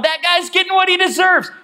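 high, mocking gloat; mock told-you-so voice; smug jeer. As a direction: sneer it like a petty grudge. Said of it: "they're not going".

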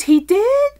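high, loud surprise; suddenly paying attention, pitch jumps up; acted-out incredulous question; startled question. Retold owner's startled question.

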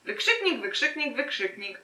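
starts high, steps down and fades; deadpan punctuation aside. Shown as a demonstration. Reader mocks the story's exclamation marks, deadpan aside.

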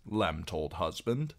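plain reading voice

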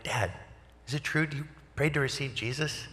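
high, soft, incredulous questioning; hopeful disbelief. Retold or imitated. Son phoning dad about his prayer, hopeful disbelief. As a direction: lift it high and soft, rising at the end.

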